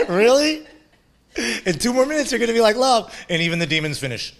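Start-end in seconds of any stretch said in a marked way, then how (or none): 0.1-3.1 teasing. Retold or imitated. Teasing demons, high pitch.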